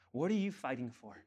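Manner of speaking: usual speaking voice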